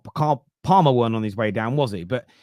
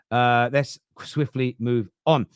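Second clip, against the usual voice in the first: ordinary delivery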